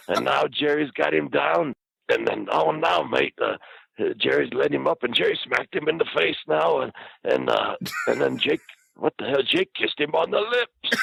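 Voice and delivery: high, stretched-out play-by-play impression; hammed-up ringside commentator; Bushwhacker's commentary. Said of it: "narrating everything to everybody".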